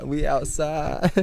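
put-on slow, drawn-out New York accent. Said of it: "New York accents".

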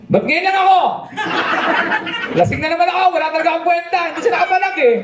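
scolding wife. Retold or imitated. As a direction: nag him like a furious wife, high-pitched. Wife scolding, voiced high.

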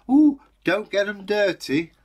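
sing-song parental warning; nagging parent. Retold or imitated. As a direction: mimic a fussy parent scolding. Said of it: "they say".

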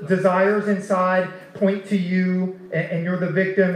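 ordinary talk, nothing marked

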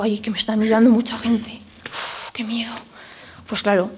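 frightened whisper, barely voiced; scared whisper. Speaker acting out stage fright for the audience, whispered. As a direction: whisper it as if everyone is staring at you. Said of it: "cuando tenemos miedo".

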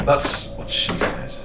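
slow, hushed aside; breathy joke whisper. Real, not acted. Player cracking a joke in a slow hushed whisper. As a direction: drop it as a sly whisper.

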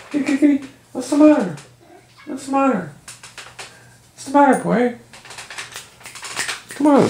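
high coaxing pet voice. Real, not acted. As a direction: call the kitty in a high sing-song. Talking to cat.

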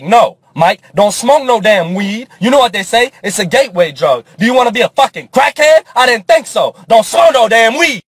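mock stern shout against weed; inner voice scolding. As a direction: bark warnings like the angel on his shoulder. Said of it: "half of me's like".

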